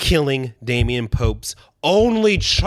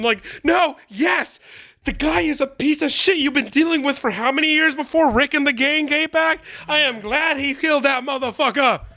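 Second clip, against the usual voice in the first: ordinary talk, nothing marked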